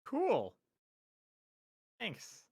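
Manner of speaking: high, dry sarcastic thanks. Pretend. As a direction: thank it with no interest at all. Dismissing the AI topics.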